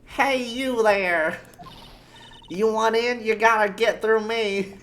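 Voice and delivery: put-on high, loud-ish old guard; old person voice. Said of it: "how do I do an old person voice?".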